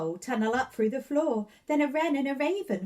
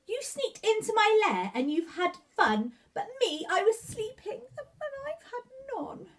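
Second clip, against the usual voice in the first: rising into a high, wailing complaint; bear whining; 11 semitones higher than usual. Acted. Bear complaining to the partying animals, rising wail. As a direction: whine like a tearful woken bear.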